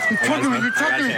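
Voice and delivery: panicked pleading. Real, not acted. Suspect pinned down by officer.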